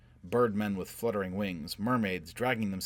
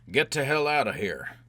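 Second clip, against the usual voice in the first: loud, raised-pitch roar; 5 semitones higher, 6 dB louder than usual. A narrator's reading. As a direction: roar the order to flee. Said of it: "Quat roared".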